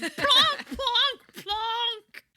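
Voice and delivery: high, loud mimicked piano plonks; imitated plonking notes. Podcaster mimicking Emma at the cut, loud comic plonking. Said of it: "cut to Emma going".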